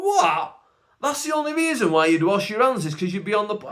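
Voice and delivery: high, loud incredulous What; outraged at the man's excuse; shocked disbelief. Retold or imitated. Comic telling of a toilet encounter, shocked and high. Shocked at a guy skipping handwashing.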